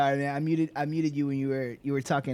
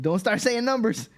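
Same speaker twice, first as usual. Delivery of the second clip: higher and louder, hammed-up alarm; mock panic; 9 semitones higher, 6 dB louder than usual. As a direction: warn him off in playful panic. Retold own panicked thought.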